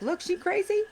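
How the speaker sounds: high-pitched mock of a judgmental caseworker. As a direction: sneer it up high like a dismissive official. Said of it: "then they'll be like".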